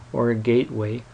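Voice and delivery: ordinary talk, nothing marked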